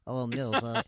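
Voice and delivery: put-on American accent. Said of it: "not only did he have an American accent".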